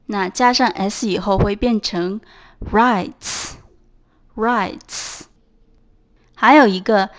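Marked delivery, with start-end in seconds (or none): 2.6-5.2 "writes" slowly, ending in fused ts; merged ts ending. Shown as a demonstration. Teacher adding S to write, "writes" ending in ts. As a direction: close "writes" on one voiceless ts sound. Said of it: "共同发音是呲".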